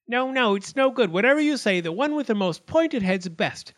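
high-pitched, indignant little arguer; squabbling acorn. A narrator's reading. Pointed acorn argues before the judge, high and boastful. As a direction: pipe up to contradict, high and insistent.

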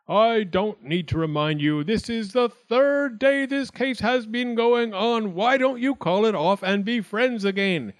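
high, insistent official voice; exasperated Wildcat judge. A narrator's reading. Wildcat reminds acorns the case drags on, high and insistent. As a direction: press the point on the whole court.

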